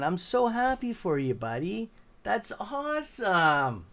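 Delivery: excited praise. Real, not acted. Congratulating friend on success.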